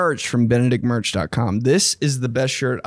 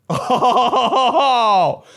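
a high startled laugh on oh; 11 semitones higher than usual. Retold or imitated. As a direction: laugh in surprise at your own voice. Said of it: "You were like".

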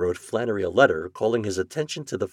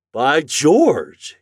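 louder, higher exclamation; struck realization; 6 semitones higher, 8 dB louder than usual. A narrator's reading. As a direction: exclaim as the point suddenly lands. Morgan struck by the letter.

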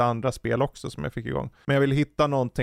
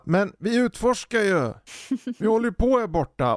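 imitating his kids; 9 semitones higher than usual. Kids answering dad over the game, higher protesting voice. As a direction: mimic the kids brushing off dad. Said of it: "De bara".

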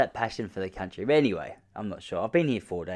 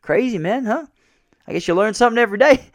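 American dude; 11 semitones higher, 7 dB louder than usual. Retold or imitated. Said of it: "He's like".

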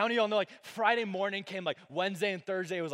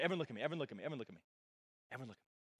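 each repeat softer until nearly a whisper; quiet the room by getting softer and softer; fading to a hush; 6 semitones lower, 9 dB quieter, much faster than usual. Real, not acted. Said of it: "There we go".

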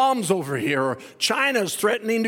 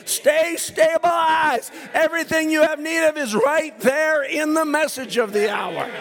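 slow, ringing calls to the congregation; full-force preaching; 4 semitones higher than usual. Acted. Pastor urging the church to stay in the light, full force. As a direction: preach it out, rising and insistent.

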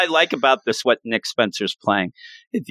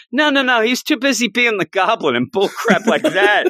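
annoyed grandson; 9 semitones higher than usual. Retold or imitated. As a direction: complain like a fed-up kid. Normie complaining about grandpa.

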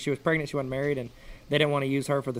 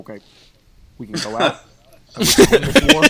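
halting presidential impression, rising to a loud finish; mock statesman, building to a shout; Obama; 9 dB louder, faster than usual. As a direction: pause between phrases, then push the last one out loud. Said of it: "he's so slow and, like, methodical".

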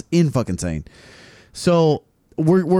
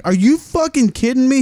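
high, incredulous angry yell; retold outburst; 11 semitones higher, 3 dB louder than usual. To the guest at his fridge, incredulous yell. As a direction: explode in disbelief. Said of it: "I was like".

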